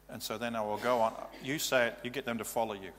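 usual speaking voice

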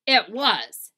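loud, emphatic bossy sister; Eugenia Lincoln. Acted. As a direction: confirm it forcefully.

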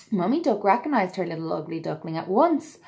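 put-on lively read-aloud to children; storybook narration. A narrator's reading. Narrator telling children the tale, bright and lively. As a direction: read it like bedtime story time.

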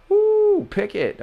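sudden high whoop of joy; excited whoop. Real, not acted. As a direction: let out a wild cheer. Touchdown celebration whoop.

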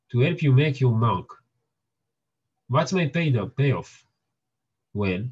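ordinary talk, nothing marked

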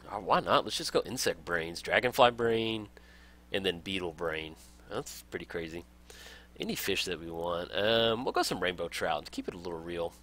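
ordinary talk

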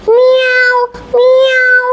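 high, long imitated meows; cat's cry. Cat with her voice back, high meowing. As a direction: meow high and hold it. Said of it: "cried the cat".

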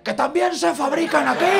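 very high, loud mock screech; imitated heckler's shout. Imitating the Elche chica heckling the comedian, high-pitched yell. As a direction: screech it like an indignant local heckler. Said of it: "Y me grita".

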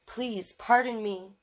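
usual speaking voice

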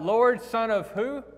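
crying out. Retold or imitated. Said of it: "She cries out".

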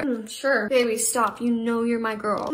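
ordinary talk

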